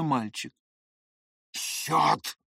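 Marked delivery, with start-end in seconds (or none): 1.5-2.4 rasped, voiceless angry oath; grated curse. A narrator's reading. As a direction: grind the curse out. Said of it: "проскрежетал Ламберт прислуги".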